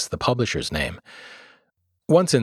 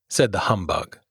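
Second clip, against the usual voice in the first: usual speaking voice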